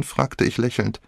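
ordinary delivery, nothing marked

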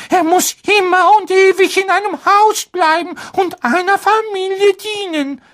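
very high, timid elf voice; a fretful little house-elf; squeaky house-elf voice. Acted. Dobby the house-elf speaks.

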